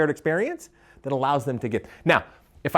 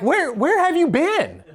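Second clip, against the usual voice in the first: mock demanding; 13 semitones higher, 3 dB louder, slower than usual. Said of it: "and I'm like".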